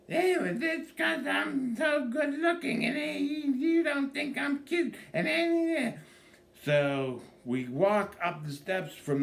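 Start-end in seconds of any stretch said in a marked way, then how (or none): 0.1-6.0 high, slow, sing-song mock wife voice. Comedian mocking his wife's vanity in a high sing-song voice. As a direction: lilt it way up like a smug wife.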